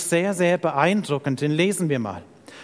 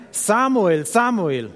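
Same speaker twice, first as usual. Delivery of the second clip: mildly raised call of a name; 3 semitones higher, slower than usual. Acted. The Lord calls sleeping Samuel at night, calling out. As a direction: call the boy, each name falling away. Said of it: "Da rief der Herr".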